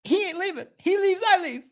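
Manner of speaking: high, mock-devoted fan voice; imitating Dan. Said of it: "He's gonna be like".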